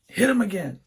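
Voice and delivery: high-pitched mock yell at the screen; imitated dad yelling. Dad cheering on Rocky at the movies, imitated yell. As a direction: yell it like an excited movie watcher, held back. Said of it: "yell things at the screen".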